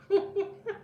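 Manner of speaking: short high laugh; a quick burst of giggles; real laughter. Real, not acted. Streamer giggling between sentences on her failed stream. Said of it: "It's so funny".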